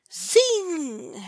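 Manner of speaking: high whizzing bullet sound; zing like a passing bullet. A narrator's reading.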